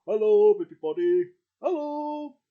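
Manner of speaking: high, cheery puppet bear greeting everyone; squeaky friendly bear saying hello. Acted. O'Hare Bear greeting the audience in a high bear voice.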